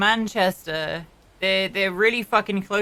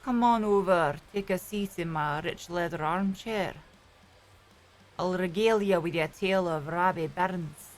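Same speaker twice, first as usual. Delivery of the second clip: softly spoken, genteel Edinburgh Scots; rich Scottish accent; 3 dB quieter than usual. Shown as a demonstration. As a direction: welcome guests like a rich Edinburgh Scot. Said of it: "What's your rich Scottish accent?".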